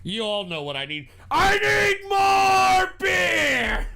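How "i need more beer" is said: loud, higher, very slow yell; drawn-out shout. Real, not acted. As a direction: bellow it out, stretching every word. Drunken demand, stretched shout.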